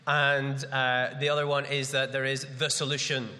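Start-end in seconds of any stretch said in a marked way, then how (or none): 2.1-3.3 THE SOLUTION stated firmly with a falling end; emphatic statement. Shown as a demonstration. Preacher announcing the final answer, emphatic falling THE SOLUTION. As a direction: land "the solution" like a full stop. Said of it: "full stop".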